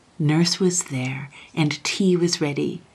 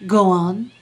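louder, impatient prompt; urging on; 3 semitones higher, 7 dB louder than usual. A narrator's reading. Young king prompts the stalling Chancellor, impatiently. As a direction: prod the speaker to continue.

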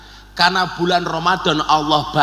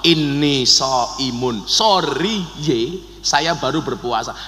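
restrained polite reply; 5 semitones lower, slower than usual. Acted. Fasting person answering a punch, calm slow restraint. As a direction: answer a blow with measured calm. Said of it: "disuruh jawab".

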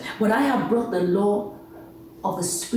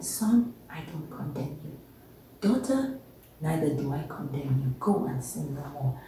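much softer, gentle and low; tender forgiveness; 4 semitones lower, 6 dB quieter than usual. Acted. God forgiving son and daughter, soft and tender. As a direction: speak it softly as forgiving God.

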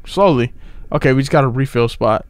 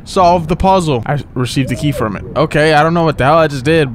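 ordinary delivery

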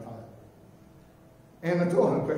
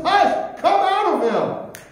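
commanding rebuke; 9 semitones higher, 6 dB louder, slower than usual. Acted. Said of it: "He says".

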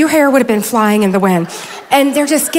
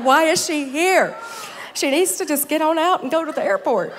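mock exasperation; 4 semitones higher, 4 dB quieter than usual. Speaker wishes the other preacher off to her flight, mock exasperated. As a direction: whine it in playful protest.

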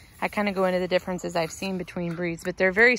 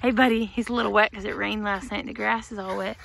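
usual speaking voice

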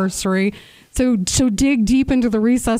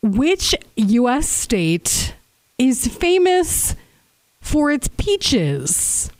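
slow quiz host; 2 semitones higher, much slower than usual. Acted. Slow dramatic quiz question.

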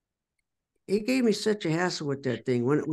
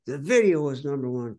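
ordinary delivery

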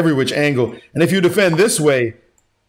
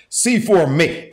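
ordinary talk, nothing marked

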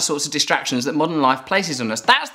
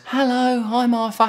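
higher-pitched put-on greeting; drawn, pitched-up hello; Arthur character voice; 6 semitones higher than usual. Shown as a demonstration. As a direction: introduce yourself in a high silly voice. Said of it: "Here's my I'm Arthur voice".

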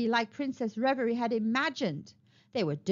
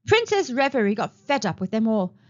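usual speaking voice

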